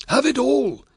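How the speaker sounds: high, loud eager offer; desperate to please, cry it out. A narrator's reading. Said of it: "cried Varus eagerly".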